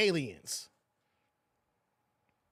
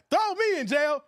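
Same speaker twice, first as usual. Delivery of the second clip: ordinary delivery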